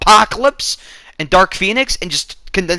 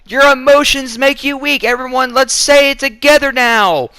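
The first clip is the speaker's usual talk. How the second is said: mock cliched hero speech; 8 semitones higher, 3 dB louder than usual. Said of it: "It's that whole spiel".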